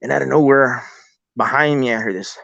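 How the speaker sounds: normal talking voice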